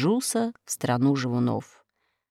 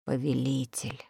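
quiet echo; 5 semitones lower, 11 dB quieter than usual. A narrator's reading. Narrator echoes the word, hushed.

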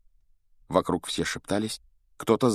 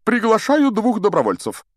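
high, loud announcement, pulling himself together; firm Lockhart; 13 semitones higher, 6 dB louder than usual. A narrator's reading. Duelling teacher calling for two volunteers, firm and high. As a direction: announce it firmly in a high, projected voice. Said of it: "сказал твёрдым голосом".

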